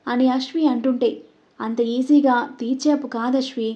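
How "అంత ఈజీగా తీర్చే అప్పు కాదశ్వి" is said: plain reading voice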